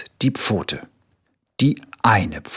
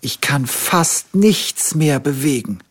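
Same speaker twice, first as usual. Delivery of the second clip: higher, slow, feeble little tiger moaning; higher plaintive voice of a poorly little tiger; sick little tiger; 5 semitones higher than usual. A narrator's reading. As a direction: whimper it like a sick cub. Sick tiger complains to bear.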